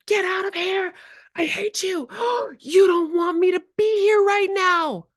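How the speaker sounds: high, loud mock tantrum yell; imitating an angry kid. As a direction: play the furious child shouting. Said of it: "A kid is yelling".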